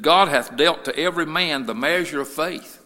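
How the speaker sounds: measured but loud verse reading; scripture reading. Real, not acted. Preacher reading Romans to the church, firm scripture reading. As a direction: read the verse out firmly at a normal pitch.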